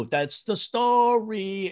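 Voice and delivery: heel traag en hoog uitgesproken slotzin; slow punchline. Retold or imitated. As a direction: rek de woorden breed uit. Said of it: "he'd go".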